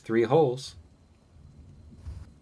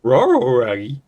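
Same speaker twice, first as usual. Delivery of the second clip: Scooby-Doo voice; 5 semitones higher, 10 dB louder, much slower than usual. Pretend.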